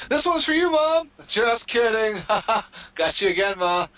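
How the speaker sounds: mocking wrestler's tribute. Wrestler pretending to honor his mom, mocking voice. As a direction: play it as a cruel prank on mom. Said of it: "he just pointed up to, for his mom".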